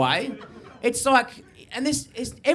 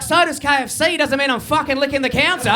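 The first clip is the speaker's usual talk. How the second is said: loud, raised mock outrage; indignant retort; 4 semitones higher, 5 dB louder than usual. Customer answers the shop lady's offer, loud mock indignation. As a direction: fire back in comic disgust.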